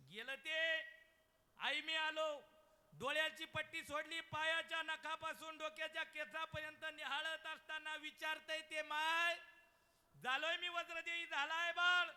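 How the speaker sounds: loud, high sermon cry; full-force preaching. Acted. Kirtankar telling the birth story, loud preaching. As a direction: preach it out to the back row.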